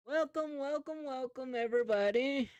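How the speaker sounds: high, projected stage announcer; announcer on a blowhorn. Acted. Emcee opens the floor show, high and projecting. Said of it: "talking into the blowhorn".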